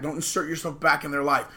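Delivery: usual speaking voice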